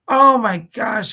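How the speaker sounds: startled at the time; surprised. Real, not acted. Realizes the hour is over.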